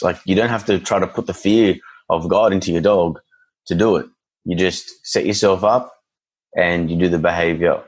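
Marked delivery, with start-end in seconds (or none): none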